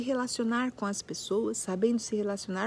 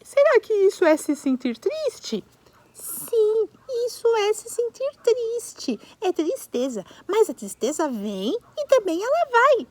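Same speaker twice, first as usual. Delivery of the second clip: puppet voices; 8 semitones higher, 6 dB louder than usual. Pretend. Puppet dialogue continues.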